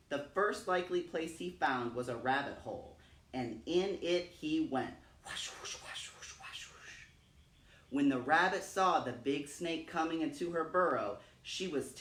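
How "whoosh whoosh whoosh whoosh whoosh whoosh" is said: whispered whoosh fading away; airy slithering sound, trailing off. A narrator's reading. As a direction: breathe the whooshes out, softer each time. Snake sliding into the hole.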